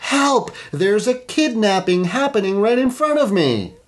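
high hammed-up call for help; pranking the neighbors with a staged alarm; mock cry for help. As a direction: yell help like a fake victim. Acting out fake kidnapping alarm.

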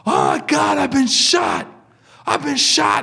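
high-pitched wounded man's wail, played up; mock panicked cry. Gunshot victim wheeled into the ER, mock panicked cry. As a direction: imitate a shot man crying out for help.

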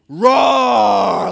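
loud mock monster roar; long, full-voiced playful bellow; Hulk. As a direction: roar like a raging beast, hammed up. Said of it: "Like full-on Hulk mode".

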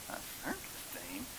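high, breathless whisper of disbelief; can't believe what he's hearing; incredulous whisper. Retold or imitated. Son whispering in disbelief at the circus idea.

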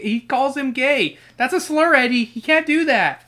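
high, loud indignant protest; outraged complaint about Eddie; mock outrage. Real, not acted. Outraged at the book's slur.

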